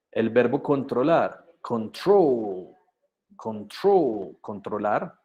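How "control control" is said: CONTROL with a long, stressed TROL; second-syllable stress. Shown as a demonstration. Teacher models the verb controlar in English, CONTROL stressed on TROL. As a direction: lean on TROL in CONTROL and stretch it. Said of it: "el acento en la segunda sílaba".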